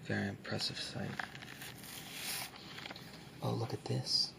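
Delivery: hushed, close soft-spoken ASMR. Acted. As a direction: speak softly, as if close to the mic. ASMR book showing, soft spoken.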